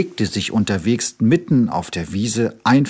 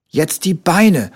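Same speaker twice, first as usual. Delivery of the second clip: higher, louder, slow order; higher commanding cub voice; bossy little tiger; 8 semitones higher, 5 dB louder, slower than usual. A narrator's reading. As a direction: order the doctor around. Tiger directs the bandaging.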